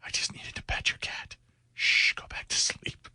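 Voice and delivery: hushed creepy mock whisper. Host voicing the burglar hushing the sleeping woman, whispered. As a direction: breathe it like a creep at the bedside.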